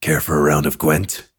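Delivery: very low monster-hunter voice; Geralt. Retold or imitated. Friend retelling what the circle kept saying, deep Geralt voice. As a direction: invite them in a deep Geralt impression. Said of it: "we all kept saying".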